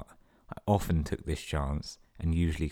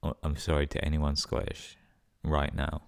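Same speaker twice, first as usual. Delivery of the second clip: usual speaking voice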